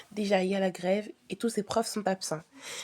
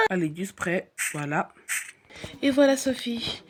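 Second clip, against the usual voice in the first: normal talking voice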